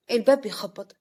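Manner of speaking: ordinary delivery, nothing marked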